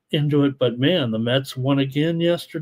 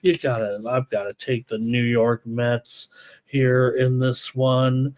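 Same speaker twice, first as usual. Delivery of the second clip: ordinary talk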